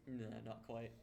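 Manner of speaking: ordinary delivery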